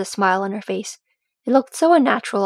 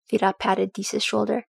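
ordinary delivery

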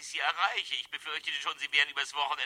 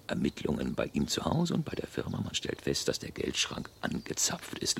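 whispered thoughts, plotting alone; hushed inner monologue; 11 semitones lower than usual. Acted. Embezzler whispering through his escape plan to himself, hushed. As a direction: murmur it under your breath like a schemer thinking aloud.

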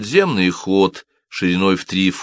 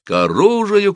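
high-pitched alarm cry; shouting; 12 semitones higher, 4 dB louder than usual. A narrator's reading. Cobbler calling the guards to arms, shouting. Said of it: "кричал Симон".

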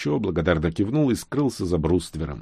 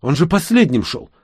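higher-pitched woman's outcry; raised, insistent protest; exclaimed protest; 8 semitones higher, 4 dB louder than usual. A narrator's reading. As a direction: exclaim it almost at full voice. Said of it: "почти в полный голос воскликнула она".

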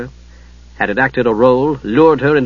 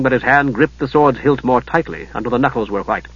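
ordinary delivery

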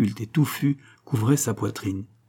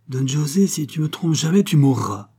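higher, louder, fierce warning; vengeful threat; 6 semitones higher, 4 dB louder than usual. A narrator's reading. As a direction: swear it like a death sentence. Stranger quotes her threat to lover. (menace de mort passionnée)

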